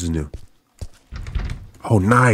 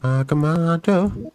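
usual speaking voice

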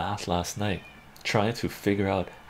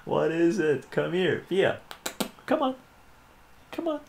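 pet talk; 8 semitones higher than usual. Real, not acted. Talking to the cat.

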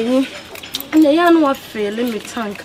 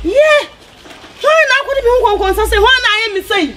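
much higher and louder, a heated yell; angry shout; 12 semitones higher, 5 dB louder than usual. Acted. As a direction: shout it right in his face.

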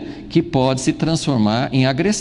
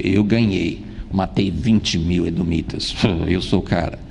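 boastful king; 8 semitones lower than usual. Acted. Boastful king voiced in sermon.

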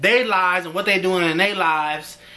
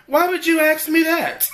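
mock outrage; 9 semitones higher than usual. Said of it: "I'm like".

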